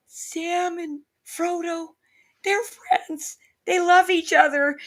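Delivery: put-on very high, slow, teary little-girl voice; weepy child. Mother imitating her daughter crying over Sam and Frodo, high and slow. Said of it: "And she's like".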